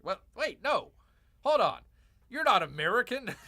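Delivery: real indignant outburst; excited correction. Real, not acted. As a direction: stop him mid-thought, worked up. Correcting chatter's heritage claim.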